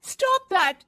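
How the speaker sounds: loud high angry Stop That; angry shout. Acted. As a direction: shout it at her in anger. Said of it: "जोर से गुस्से से बोल देती है".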